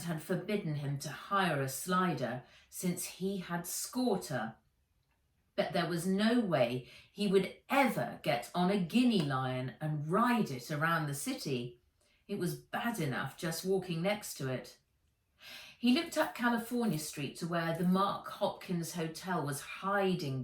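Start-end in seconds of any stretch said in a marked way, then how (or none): none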